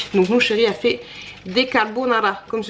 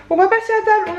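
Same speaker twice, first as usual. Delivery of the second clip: upbeat; 11 semitones higher, faster than usual. Real, not acted. Announcing mealtime brightly.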